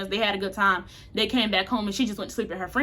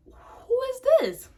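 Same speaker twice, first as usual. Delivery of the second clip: startled call; 12 semitones higher, slower than usual. Retold or imitated. Woken by banging at 2am.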